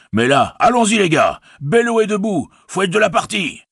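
high, rousing farmer's call; rallying cry. A narrator's reading. Farmer calls his sons to the fight, rousing. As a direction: rouse the lads to join in.

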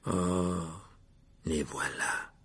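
low, quiet sour remark; understated resentment; bitter. A narrator's reading. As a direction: let it sink bitterly. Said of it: "dit Nick d'un ton amer".

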